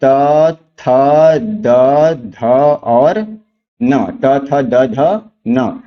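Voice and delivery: त, थ, द, ध, न said slowly, each letter alone with pauses; teacher sounding out त थ द ध न, then running them together; letters one by one. Shown as a demonstration. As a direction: spell out त थ द ध न for the class. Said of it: "सबसे पहले त वर्ग क्या हो जाएगा".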